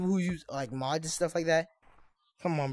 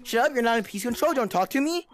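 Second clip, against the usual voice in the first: put-on cocky trash-talking kid, a bit higher, taunting; toxic gamer kid; 4 semitones higher than usual. Gamer taunting lobby players as smug bully kid. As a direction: sneer at the other players like a smug little bully.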